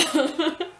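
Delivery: high rising laugh; light laugh. Real, not acted. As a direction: laugh as you wrap up. Laughing at ending.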